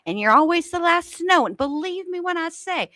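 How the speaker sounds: high sweet mock voice; overly sweet high condescending voice; Brittany impression. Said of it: "here comes Brittany".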